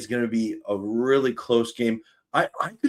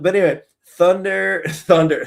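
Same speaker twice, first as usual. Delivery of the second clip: laughing; 7 semitones higher, 6 dB louder than usual. Real, not acted. Laughing at own bad accent.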